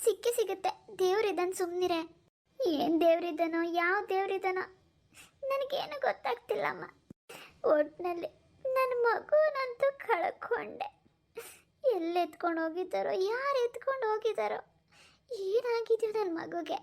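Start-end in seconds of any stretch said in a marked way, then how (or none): 5.5-16.8 high-pitched, crying despair; grieving mother in tears; tearful lament. Acted. Mother grieving her missing baby.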